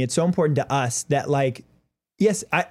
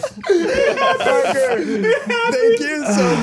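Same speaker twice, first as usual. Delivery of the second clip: high, slow, laughing through tears; laugh-cry the words out; laughing hard; 4 dB louder, much slower than usual. Real, not acted. Laughing-crying after the pie.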